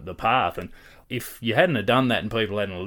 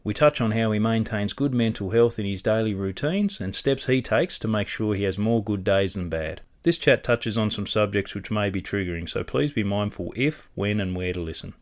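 louder, upbeat host intro; podcast intro read; 6 dB louder than usual. Acted. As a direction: sell the episode like a presenter. Reads the episode intro.

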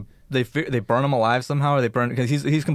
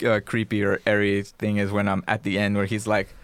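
usual speaking voice

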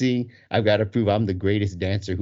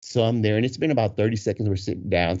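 ordinary delivery

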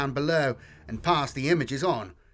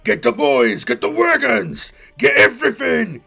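much louder orc bellowing commands; yelled orders; 8 semitones higher, 10 dB louder than usual. Acted. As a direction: rouse the whole warband. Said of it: "he yelled at Gorag".

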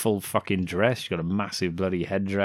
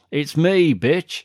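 cocky reveal; 7 semitones higher, 7 dB louder, slower than usual. Retold or imitated. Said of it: "He turns around and goes like that".

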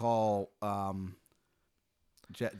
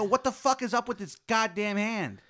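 high, loud, furious demand; loud high angry yell; angry outburst; 10 semitones higher, 6 dB louder than usual. Acted. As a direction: shout it at the doctor. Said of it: "I go".

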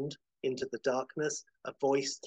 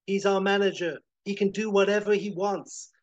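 high, loud firm declaration; defiant retort; 7 semitones higher, 7 dB louder than usual. Acted. Bev tells Chet off while saving Howard, defiant. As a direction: stand up loudly for your manager. Bev defies villain in rescue.

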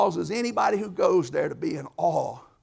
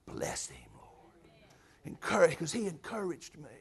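hushed prayer; 8 dB quieter than usual. Retold or imitated. Prayer for Brother Danny.

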